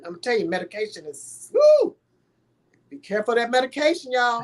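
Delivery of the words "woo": sharp high exclaimed whoop; high loud whoop. Real, not acted. Warning the audience about medication, whooping. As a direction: let out a high loud woo.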